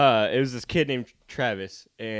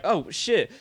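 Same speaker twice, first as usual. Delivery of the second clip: acted dialogue; 6 semitones higher, 5 dB louder than usual. Principal and kid dialogue acted.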